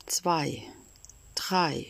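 whispered count; hushed, holding the silence. A narrator's reading. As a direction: breathe the number out.

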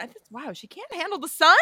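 ordinary delivery

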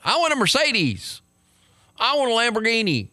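mock the greedy wish-list prayer; greedy self-seeker. Mocking a health-and-wealth pray-er, high whiny mock voice. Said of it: "self-seeking".

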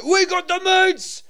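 high, slow shouted catchphrase; yelled slogan. Acted. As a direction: greet callers by shouting it. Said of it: "calling up Arby's and being like".

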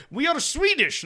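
high-pitched, bad mock Swedish; terrible Swedish accent. Band introducing themselves to worker, terrible Swedish accent. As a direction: do a badly faked Swedish accent. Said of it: "that's a terrible Swedish accent".

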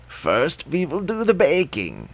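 put-on sweetly plotting old witch; scheming witch voice. A narrator's reading. Witch speaks.

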